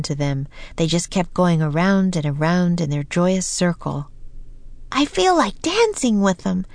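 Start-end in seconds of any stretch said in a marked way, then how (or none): 5.0-6.7 high-pitched, eager character voice; voiced Annie. A narrator's reading. Annie longs to join the dancers, high eager voice. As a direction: pitch it well up for the girl. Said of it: "said Annie".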